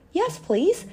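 high, eager acceptance; joyful. A narrator's reading. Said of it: "said Chinky joyfully".

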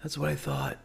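quiet, unbothered victory line; a touch of hushed smugness; smug soft comeback. Retold or imitated. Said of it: "he's like".

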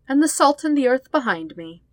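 high, loud gleeful boast. Acted. Sister boasting to her brother, loud and gleeful. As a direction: crow it out with glee.

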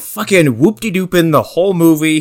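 ordinary delivery

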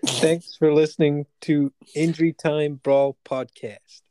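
slow, hammed-up American accent, Texan cowboy twang. Reading a shout-out to American listeners in a put-on American accent. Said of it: "if you could do it in an American accent".

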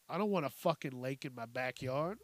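usual speaking voice

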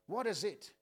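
usual speaking voice

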